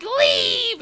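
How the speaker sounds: high mock shriek; screaming in character. Scare actor ordering visitor away, high imitated scream. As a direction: shriek it as a haunted-house creature. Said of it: "She was screaming like that".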